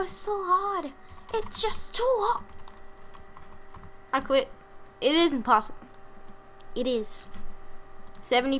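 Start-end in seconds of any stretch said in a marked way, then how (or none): 0.0-2.4 high whine, drawn out; whiny voice. Pretend. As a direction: whine like a frustrated kid. Whining about the hard level.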